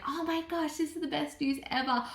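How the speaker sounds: imitated husband's high, hushed excitement; over the moon. Husband hearing pregnancy news, over the moon. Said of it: "he was just over the moon".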